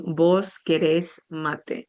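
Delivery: "¿Vos querés mate?" said slowly, each word spaced, with the stress on the last syllable of "querés"; slow voseo demo. Shown as a demonstration. Teacher modelling the Uruguayan vos form, slow and clear. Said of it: "vas a decir".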